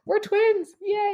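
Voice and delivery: high-pitched happy little cheer; tickled squeal of delight; playful glee. Real, not acted. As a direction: celebrate it in a high, giggly voice. Playful celebration with laughing listener.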